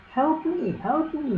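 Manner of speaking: high, thin pleading elf; squeaky elf voice. Acted. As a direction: squeak for help like a tiny elf. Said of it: "he would say again and again".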